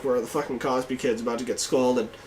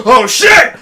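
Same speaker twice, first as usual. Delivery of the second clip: startled; 8 semitones higher, 12 dB louder than usual. Retold or imitated. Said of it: "And Nick just goes".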